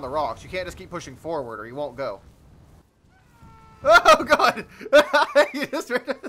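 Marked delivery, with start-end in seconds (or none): 3.8-6.3 loud, high cry breaking into laughter; laughing outburst. Real, not acted. Gamer sees man flee from spider, loud laughing outburst. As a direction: burst out laughing on a startled shout. Startled by man running away.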